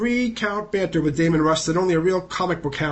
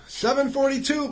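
a much higher, eager mock blurt; 8 semitones higher than usual. Reporter voicing Reader unable to hold back, eager blurt. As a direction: blurt it out, too excited to wait. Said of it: "blurted Reader".

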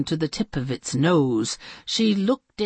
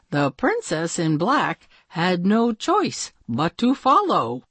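plain reading voice

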